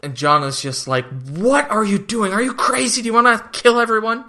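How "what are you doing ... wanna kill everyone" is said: high-pitched, incredulous mock protest; exasperated imitation at raised pitch; acted outrage. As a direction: act out a frantic, disbelieving demand. John's outraged reaction, acted out.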